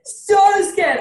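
high, loud burst of remembered fright; emphatic exclamation. Retold or imitated. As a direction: stress how scared you were, loud and high. Answers whether she was scared.

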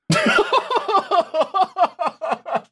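long, high-pitched laughing fit; high laughter that keeps rolling; real laughter. Real, not acted. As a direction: crack up at your co-host's remark. Cracks up at co-host's doubt.

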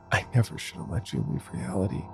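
ordinary delivery

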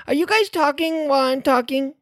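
high, fast indignant crier; annoyed town crier. Acted. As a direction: snap at them in the crier voice.

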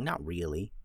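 plain reading voice

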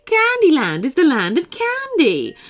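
high, loud, breezy; jolly beach woman. Acted. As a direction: wave it all away cheerfully. Woman character voice.

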